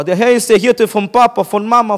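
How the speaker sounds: ordinary talk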